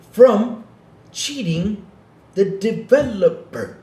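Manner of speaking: high, halting imitation of a slow speaker; mock slow talker. Mimicking the Dreamcast guy who called him slow, exaggerated slow talk. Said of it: "Yeah, he talks, though".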